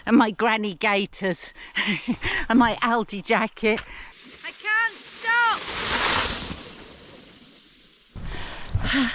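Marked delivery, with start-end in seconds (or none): 4.5-9.1 very high delighted squeal; excited squeal. Real, not acted. Skier racing downhill out of control, shrieking high. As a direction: let the words fly up as you slide away. Speeding downhill, can't stop.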